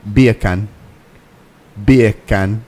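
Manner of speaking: loud British beer can, sounding like bacon; English accent passing for Jamaican BEE-uh-kun. Shown as a demonstration. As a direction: repeat it punchily in a mock English accent. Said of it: "sounds like I'm saying bacon in a Jamaican accent".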